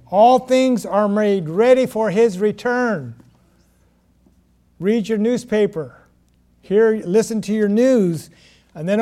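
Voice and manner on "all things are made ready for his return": ordinary talk, nothing marked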